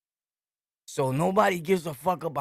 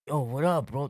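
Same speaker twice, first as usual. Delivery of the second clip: ordinary talk, nothing marked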